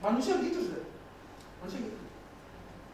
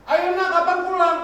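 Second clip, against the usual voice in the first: louder, higher pleading father; pleading call; 10 semitones higher, 9 dB louder than usual. Retold or imitated. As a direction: call out to the son, begging. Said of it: "jawaban Anda". (ayah memohon dengan suara keras)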